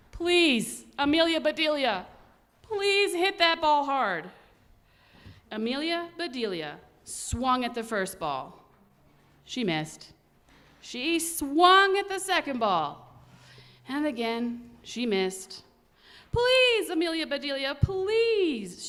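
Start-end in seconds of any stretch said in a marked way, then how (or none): 0.1-4.3 worried plea. Acted. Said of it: "The Grizzlies were worried". 16.3-18.9 higher, louder begging shout; shouted plea. Acted. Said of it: "shouted the Grizzlies".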